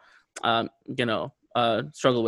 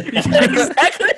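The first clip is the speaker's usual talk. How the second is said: laughing high through the words; 14 semitones higher, 7 dB louder than usual. Real, not acted. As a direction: giggle out the agreement. Laughing at the dinner joke.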